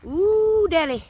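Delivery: high, loud playful ooh; mock-flirty delight. Real, not acted. Beekeeper pleased by what he sees, playful high ooh. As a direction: tease it like a cheeky catcall.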